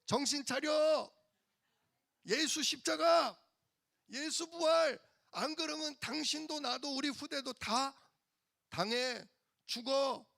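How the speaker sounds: high, loud, slowed shout of a wife rousing her husband; wife's shout. Retold or imitated. Zipporah shouting to wake up Moses, loud and high. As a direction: cry out as if shaking him awake.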